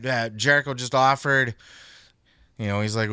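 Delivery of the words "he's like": ordinary talk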